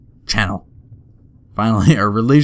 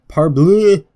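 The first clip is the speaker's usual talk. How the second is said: Parbleu! with a loud, high French flourish; French exclamation; 7 semitones higher, 7 dB louder than usual. Acted. Fogg crying out Parbleu! in a French accent. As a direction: toss out Parbleu! like a proud Frenchman.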